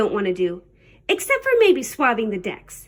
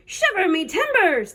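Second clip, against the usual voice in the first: high, surprised pirate exclamation; 6 semitones higher than usual. A narrator's reading. Pirate reacts to landing on the wrong beach, exclaiming. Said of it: "the pirate said".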